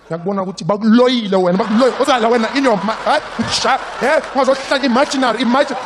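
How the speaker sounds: loud, high accusing granny; scolding grandma. Retold or imitated. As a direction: scold the boy as bewitched.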